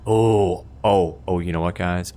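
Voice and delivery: ordinary talk, nothing marked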